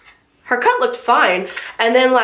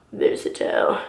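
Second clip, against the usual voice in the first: "Versatile." whispered again softly to herself; soft whispered "Versatile." checking the word; whispered repeat; 6 dB quieter than usual. Shown as a demonstration.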